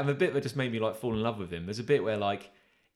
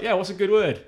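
Australian man; 9 semitones higher, 8 dB louder than usual. Retold or imitated. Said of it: "the most Australian man".

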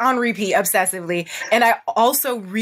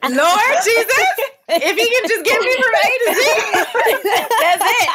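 mock prayer; 11 semitones higher than usual. Mock prayer to God, laughing.

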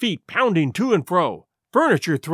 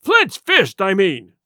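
impatient cry; 4 dB louder, slower than usual. Acted. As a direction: cry out, cursing, then drop in pitch. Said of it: "he cried".

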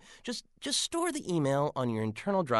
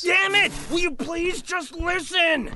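high, loud, slowed furious yell; furious shout; 10 semitones higher, 10 dB louder, slower than usual. Acted. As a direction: bellow it out, fed up. Furious outburst at the assistant.

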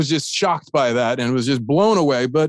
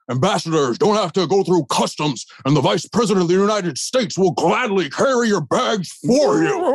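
hammed-up grand politician, climbing high at the end; a mock gracious vice president, ending far up on you; Nixon voice; 4 semitones higher than usual. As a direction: Nixon grandly offering to carry the bags, lightly imitated. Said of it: "and he goes".

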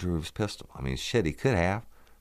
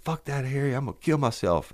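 put-on drunk man refusing; 12 semitones higher than usual. Drunk man refuses, voiced again.

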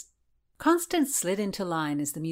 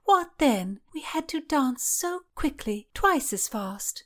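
pitched-up chatty lady-in-waiting; Mary's voice; 8 semitones higher, 3 dB louder than usual. Acted. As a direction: beckon her in a lilting high voice. Mary complains at length.

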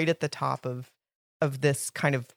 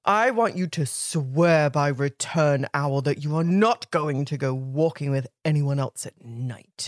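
deep Sirius voice; 3 semitones lower than usual. Acted. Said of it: "No more normal voice".

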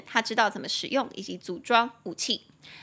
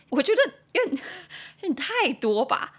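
high voice breaking into a laugh; amused disbelief; 7 semitones higher, 4 dB louder than usual. Real, not acted. Teacher reacting to four assassinated presidents, laughing disbelief. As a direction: laugh midway through, pitched up in disbelief.

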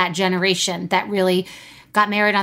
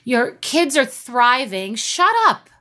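much higher, impatient telling-off of a friend; exasperated pep talk; 6 semitones higher than usual. Retold or imitated. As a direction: snap her friend out of self-pity. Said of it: "I'm like".

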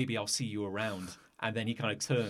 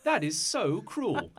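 high-pitched wounded protest, slowing at the end; acted hurt, higher and louder, drawn out; wounded schoolgirl; 9 semitones higher, 4 dB louder than usual. Hurt girl protests after an eraser hits her head, high wounded protest. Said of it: "then she clutches her head".

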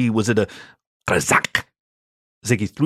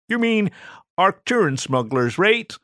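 higher, lightly voiced alien sidekick; a nosy little alien butting in; Ziggy's voice; 9 semitones higher than usual. Alien Ziggy pipes up to correct the storyteller, higher voice.

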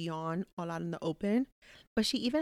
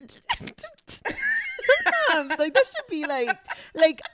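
laughing; 11 semitones higher, 7 dB louder than usual. Real, not acted. Laughing about sneezing.

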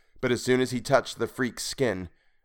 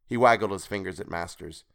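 ordinary delivery, nothing marked

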